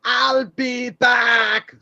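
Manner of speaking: very slow, high shouted movie line; hammed-up Terminator shout; Arnold impression. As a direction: bellow it like the action hero. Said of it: "He goes".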